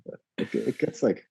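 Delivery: ordinary talk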